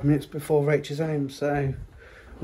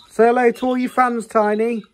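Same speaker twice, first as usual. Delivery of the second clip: high, louder sing-song coaxing; higher and louder playful prodding; coaxing call; 8 semitones higher, 7 dB louder than usual. Real, not acted. Coaxing child to greet fans.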